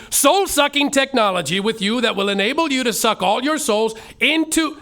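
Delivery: normal talking voice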